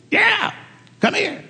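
very high, short parrot squawks. Acted. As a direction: summon him like a bossy talking parrot. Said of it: "the parrot said".

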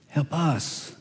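quiet, fading help us; 1 soft plea. Retold or imitated. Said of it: "In other words".

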